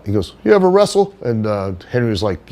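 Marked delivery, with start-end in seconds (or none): 0.4-1.1 much higher and louder meathead buddy voice; cocky wrestler dude-bro greeting, high and loud; Johnny's bro voice. Retold or imitated. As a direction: greet him like an overly chummy gym bro, pitched way up. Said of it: "that's how he would talk".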